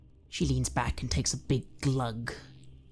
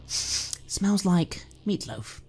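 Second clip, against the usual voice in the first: ordinary talk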